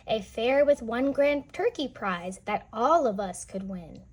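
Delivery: lively storybook reading voice; read-aloud narration. A narrator's reading. Teacher reading a picture book to children, lively storytelling. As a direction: read the rhyme to the kids with bounce.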